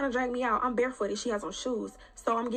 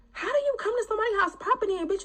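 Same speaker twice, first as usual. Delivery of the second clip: indignant; 10 semitones higher, 3 dB louder than usual. Real, not acted. Indignant rant about intruder.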